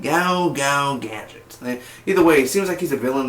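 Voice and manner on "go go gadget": Inspector Gadget impression. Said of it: "I used to do it really good".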